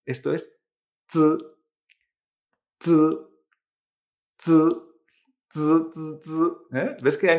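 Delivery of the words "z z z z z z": z as a ts with throat vibration; z said as a voiced, unaspirated "tsz"; buzzing Chinese Z. Shown as a demonstration. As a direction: buzz the z like a bee, no i vowel. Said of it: "se nota una vibración en la garganta".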